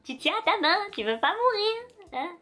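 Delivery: high, loud mock threat; menace indignée. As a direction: warn them off in a high, drawn-out voice. Menace qui touche ses cheveux. (menace aiguë et indignée)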